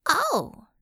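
high, loud oh of understanding; sudden realization. Acted. Bella realizing what Upir means, high surprised oh. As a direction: it suddenly clicks.

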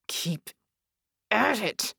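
forcing each syllable out; halting, effortful rasp; strained hiss. A narrator's reading. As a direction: hiss it through effort, word by word. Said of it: "Red hissed, forcing each syllable out".

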